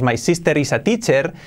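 English in a learner's Spanish accent. Shown as a demonstration. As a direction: say it with Spanish vowels and tapped r's. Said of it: "Y podría decir teacher".

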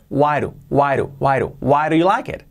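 teacher drilling linked why do. Shown as a demonstration. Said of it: "Fijaos cómo cambia".

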